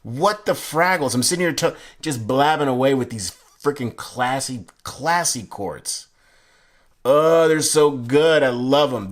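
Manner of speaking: loud, high, thrilled raving; gushing. Real, not acted. Reviewer admiring classy court cards, gushing excitedly. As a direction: rave about it with drawn-out emphasis. Gushing over the card deck.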